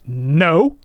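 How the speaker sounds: retold baffled, indignant refusal; bemused indignation. Old priest answering his student's question, bemused indignation. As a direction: imitate a mildly affronted old priest. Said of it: "bemused indignation, and he said".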